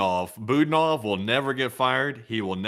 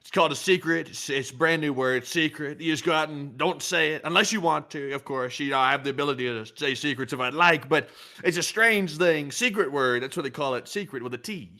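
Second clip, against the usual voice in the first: smug president impression; 5 semitones higher than usual. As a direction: talk like a smug know-it-all. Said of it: "turning to the president".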